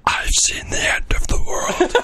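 high breathy doom voice; dramatic whisper-shout. Pretend. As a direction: proclaim doom in a hoarse whisper. Dramatic voice over zoom.

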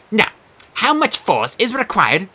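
high prim quizmaster creature; Sphinx voice. Acted. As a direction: play a fussy exam monster.

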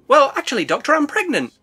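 high, coy lady shark; high character voice. Pretend. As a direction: squeak it out coyly.

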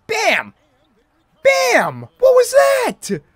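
high disbelieving yell; shocked shout. Real, not acted. Shocked at a missed play.

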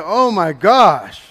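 slow, drawn-out and loud oh my gosh; amazed exclamation; stunned gasp. Retold or imitated. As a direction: let it out in disbelief. Stunned at the festival sights.

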